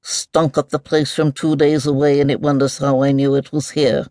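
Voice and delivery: distracted, mumbling mole; absent-minded soliloquy, lightly voiced; talking to itself. A narrator's reading. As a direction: mutter it as a private grumble. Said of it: "seemed to be talking to itself".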